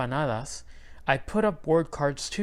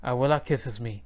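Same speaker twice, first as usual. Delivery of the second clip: ordinary delivery, nothing marked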